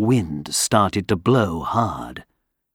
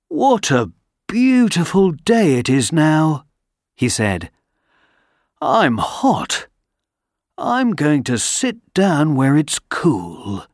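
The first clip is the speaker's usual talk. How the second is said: higher, cheerful; happy man voice; 4 semitones higher than usual. Acted. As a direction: bask in the warm day. Man enjoys sun.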